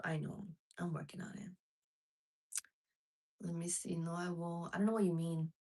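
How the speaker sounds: ordinary talk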